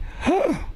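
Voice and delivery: short high gasp; quick, audible intake of shock. Acted. Storyteller reacting to the word jumble, short high gasp. Reader gasps between pages.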